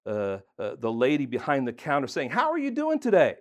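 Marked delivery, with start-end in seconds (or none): 2.3-3.4 much higher, cheery hello to a stranger; bright friendly greeting. Retold or imitated. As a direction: greet her as warmly as you can.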